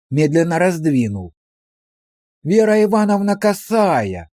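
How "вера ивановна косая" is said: feigned surprise. A narrator's reading. Policeman discovers the woman behind the curtain, falsely surprised. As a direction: act amazed to find her here, voice raised. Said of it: "фальшиво удивился он".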